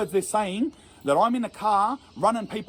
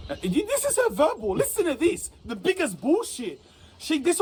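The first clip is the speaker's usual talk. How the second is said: much higher indignant burst; outraged; 8 semitones higher than usual. Real, not acted. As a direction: pitch it up high in disbelief. Outraged at judge's verdict.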